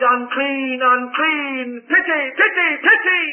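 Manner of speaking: rising pleading wail; lepers crying out. A narrator's reading. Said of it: "they cried".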